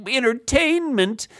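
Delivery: high, slow whiny impression; mock the listeners with a high drawn-out whine. Mocking people who skip the podcast, high and whiny. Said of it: "and they're like".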